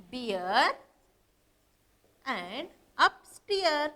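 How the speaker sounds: very slow, with a long pause after Bear; slow, separated modelling. Shown as a demonstration. Teacher showing pupils a rhyming word pair, slowly separated. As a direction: space out each word of the rhyme.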